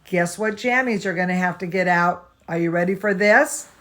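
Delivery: ordinary delivery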